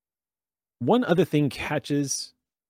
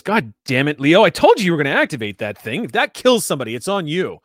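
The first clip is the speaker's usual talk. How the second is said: louder, higher angry telling-off; scolding; 4 semitones higher, 7 dB louder than usual. Acted. Old man scolding Leo for setting off the alarm, angry and louder. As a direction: snap at the careless coworker.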